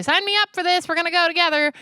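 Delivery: put-on high, excited kid pleading; eager kid voice.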